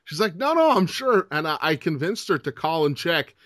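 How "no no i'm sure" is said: mock mom. Mom voiced in a story.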